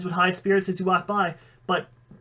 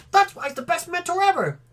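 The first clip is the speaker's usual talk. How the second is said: put-on much higher excited child voice; excited little kid; 11 semitones higher than usual. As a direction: gush like a small fan. Little mini dragon talks.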